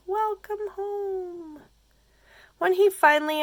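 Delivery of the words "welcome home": high, slow, softer welcome; gentle character greeting. Acted. Sea friends welcoming Kelp back, high and slow. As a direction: greet him tenderly in a high voice.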